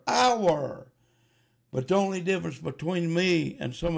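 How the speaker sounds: loud, high, accusing sermon climax; fiery preaching. Acted. Preacher accusing the congregation, rising to a loud fiery shout.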